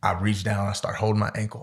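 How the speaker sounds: ordinary talk, nothing marked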